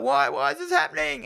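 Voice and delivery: high-pitched mock panic; a frantic wail, hammed up; panicked high squeal. Retelling Anton freaking out.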